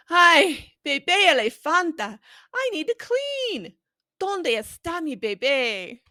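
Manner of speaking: put-on storybook parent calling for her baby; fussy parent elephant. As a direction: fret like a busy parent looking for baby. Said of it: "I did a high-pitched voice for the baby".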